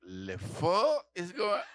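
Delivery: slow mock French swear; fake French cursing. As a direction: curse with some class. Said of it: "That's French if you wanna curse".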